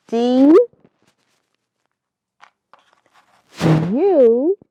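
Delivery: each letter called out loud and slow; letters D, U, C, K called one by one; slow loud spelling. Shown as a demonstration. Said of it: "let's spell duck".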